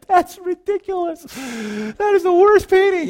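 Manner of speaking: put-on very high, slow, loud sneering kid voice; a child scoffing at a classmate's painting, in a very high jeer; mocking kid. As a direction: jeer at it like a snotty toddler. Imagined toddlers mocking a painting.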